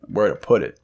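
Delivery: usual speaking voice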